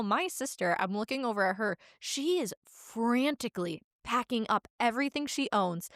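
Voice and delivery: ordinary delivery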